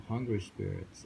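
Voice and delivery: ordinary talk, nothing marked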